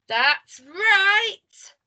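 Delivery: high, loud, stretched-out words; triumphant cry. A narrator's reading. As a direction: cry it out as if catching someone. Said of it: "cried little Miss Bossy".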